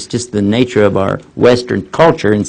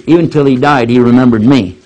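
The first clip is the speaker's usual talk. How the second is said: ordinary delivery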